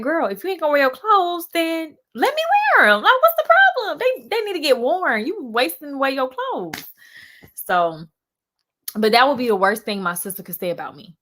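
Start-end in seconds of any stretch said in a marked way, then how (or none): 0.0-6.8 loud, high-pitched mock protest; exasperated sibling argument, high and loud; retold exasperation. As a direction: plead your case to your sister, voice way up. Retells arguing with sister over clothes.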